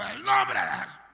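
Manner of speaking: loud re-enacted deliverance cry; shouted exorcism command. Pastor laying hands on a witch, shouting. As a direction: command it out forcefully. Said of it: "entre más gritaba".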